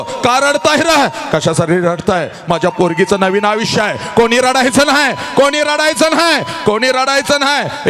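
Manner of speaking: a proud father calling out over the crowd; father shouting. Acted. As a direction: order everyone to stop crying at full volume. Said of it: "सगळ्यांना ओरडतो".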